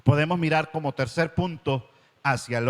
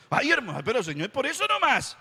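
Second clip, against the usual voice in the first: much higher, whiny excuse; excusing churchgoer; 8 semitones higher than usual. Retold or imitated. Preacher voices a believer excusing his mistreatment, whiny. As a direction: brush it off with a whine. Sinner excusing himself to the Lord.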